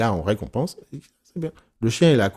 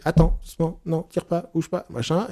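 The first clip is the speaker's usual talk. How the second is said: higher-pitched string of little commands; nagging dog owner; 6 semitones higher than usual. Acted. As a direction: mimic someone constantly correcting their dog. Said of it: "ce que font les gens avec".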